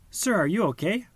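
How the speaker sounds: high, bright and friendly; open and friendly. A narrator's reading. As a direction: kindly check on him. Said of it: "asked the friendly blue fish".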